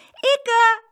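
put-on high, loud childlike shout; calling out. A narrator's reading. Girl answering the farmer's call, high shout. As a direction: shout back from a distance. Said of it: "riep Saskia".

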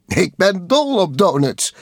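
high, loud, gleeful cry; gleeful character voice. A narrator's reading. Police officer joining the donut spinning, high and gleeful.

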